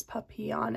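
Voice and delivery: papillon with a Y sound, pa-pee-yon; French-style papillon. Shown as a demonstration. Vlogger giving the pronunciation she found, papillon pa-pee-yon. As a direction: say papillon with a y in place of the L's. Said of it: "the Y sound instead of the L's".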